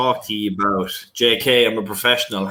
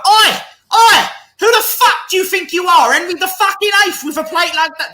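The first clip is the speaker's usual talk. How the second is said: high, loud angry Irish mum yelling; mother's furious shout; 6 dB louder than usual. Retold or imitated. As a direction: scold him like an outraged mother at the door. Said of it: "That's actually the way she said it".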